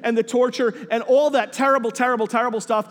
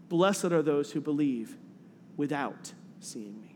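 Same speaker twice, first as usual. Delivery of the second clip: scripture reading; 3 semitones lower, 3 dB quieter than usual. Acted. Reads the scripture passage aloud.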